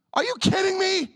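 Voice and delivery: high disbelieving cry; are you kidding me, sky high; incredulous. Real, not acted. Incredulous outburst.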